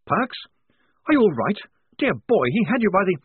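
worried officer voice; concerned officer. A narrator's reading. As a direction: fuss over the fallen private. Blouse's voice.

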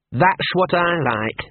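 bitter mongrel, stretching the words out. A narrator's reading. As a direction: say it slowly, with sour sarcasm. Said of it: "said Gaspode bitterly".

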